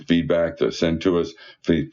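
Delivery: ordinary talk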